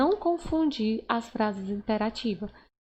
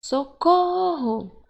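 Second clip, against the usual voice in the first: high, loud, long-held call for help; demonstrated distress call, vowel stretched out; expressive exclamation; 5 semitones higher, 5 dB louder, much slower than usual. Shown as a demonstration. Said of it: "elas são bem expressivas".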